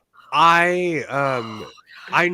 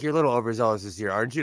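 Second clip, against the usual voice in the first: teasing quick remark; 5 semitones lower, much faster than usual. Retold or imitated. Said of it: "she's like".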